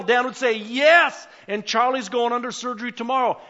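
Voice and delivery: building, loud preacher's appeal; fervent preaching. Acted. Pastor presses the church to answer God, preaching loudly. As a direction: rouse the congregation, voice climbing. Preaching rises to full force.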